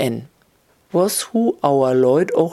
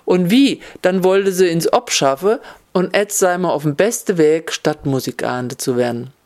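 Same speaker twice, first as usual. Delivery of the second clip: ordinary delivery, nothing marked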